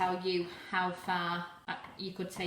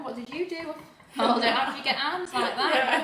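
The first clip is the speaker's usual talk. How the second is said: laughing; 6 semitones higher, 9 dB louder than usual. Real, not acted. Joking about her arms.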